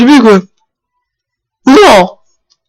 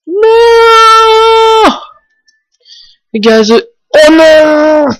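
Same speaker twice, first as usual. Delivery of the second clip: anguished cry; 11 semitones higher, much slower than usual. Real, not acted. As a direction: wail as the Pokémon falls. Pokémon Gazo dying.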